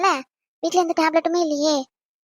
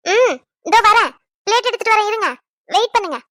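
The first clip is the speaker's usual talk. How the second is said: calling back; 6 semitones higher, 4 dB louder than usual. Acted. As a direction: call out that you're on the way. Calls back from kitchen. (இழுத்து உரக்க பதில் குரல்)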